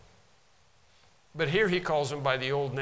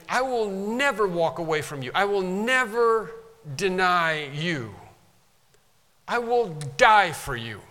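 loud, insistent vow, raised high; emphatic vow; 5 semitones higher, 5 dB louder than usual. Retold or imitated. Peter boldly vowing loyalty to Jesus, loud and emphatic. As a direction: swear it with full force. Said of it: "That's very emphatic".

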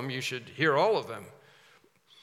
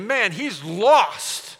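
slow, loud, higher, dismissive; scornful verdict; 5 semitones higher, 7 dB louder, much slower than usual. Acted. Pharisees sneering at Jesus among sinners, slow and scornful. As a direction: drag it out in contempt.

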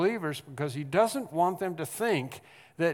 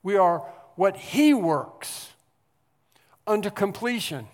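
louder, building preacher's cry; rising preaching; 3 semitones higher, 6 dB louder than usual. Acted. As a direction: lift it to the throne. Preaching builds to full force.

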